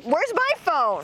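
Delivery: startled slow worried ask; sudden alarm. Real, not acted. Sudden panic lost phone.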